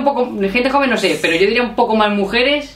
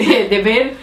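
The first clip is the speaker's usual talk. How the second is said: ordinary talk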